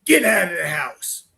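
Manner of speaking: mock menacing spirit order; imitated spirit voice. Investigator mimicking a captured recording, mock spirit command. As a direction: imitate a ghost ordering people out. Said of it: "And we got".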